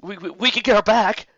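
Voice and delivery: loud, high stammering plea; nervous stammer. Acted. Henchman stammering to his furious boss, nervous. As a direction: babble it out in fear.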